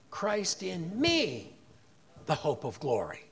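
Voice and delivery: slow build to ME, low fall after it; stress on ME. Shown as a demonstration. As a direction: land slowly on "me", then drop low. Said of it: "emphasis on the third word".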